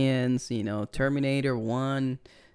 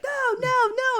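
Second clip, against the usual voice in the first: very high squeaky wallet; slower than usual. Pretend. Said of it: "The wallet's like".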